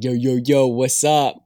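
loud, hammed-up early-2000s teen slang voice; bad teenager impersonation. Podcaster mocking how the show's characters talk, bad teenager impersonation. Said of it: "a bad impersonation of what an early two thousands teenager".

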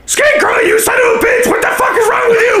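high furious yelling; furious shouting. Acted. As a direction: scream at the thief. Yelling at thief party member.

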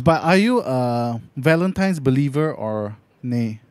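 usual speaking voice

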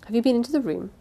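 plain reading voice

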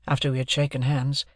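ordinary delivery

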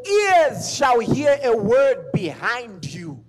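slow, loud preaching of the verse; preaching at full force. Acted. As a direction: proclaim each word slowly and loudly.

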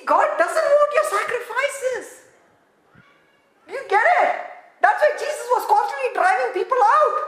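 high, loud, laughing through the words; laughing shout. Real, not acted. Preacher laughing to the crowd about sacrifices, loud and high.

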